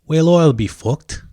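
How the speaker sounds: "Whale oil, beef, hooked" run together, high and loud, so it sounds like "wail oil beef hooked"; said together in an Irish accent. Shown as a demonstration. Showing viewers how the words sound together, Irish accent. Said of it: "it sounds like this".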